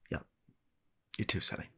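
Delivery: ordinary talk, nothing marked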